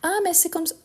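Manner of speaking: imitation moqueuse. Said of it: "arrêtez avec la remarque".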